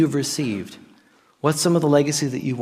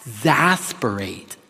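slow, low "Exasperate", syllables pulled apart; drawn-out word demo; 7 semitones lower, 5 dB quieter, slower than usual. Shown as a demonstration. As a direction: spell out "Exasperate" slowly by ear. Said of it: "say that with me".

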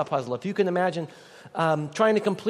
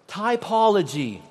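"Typology." in a lightly put-on British accent, drawn out slowly; 2 semitones higher, slower than usual. Shown as a demonstration. Pastor leads the congregation in saying "Typology.", mock British accent. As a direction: say "Typology." like a playful mock Englishman, slow. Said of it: "Can you say with me in a British accent?".

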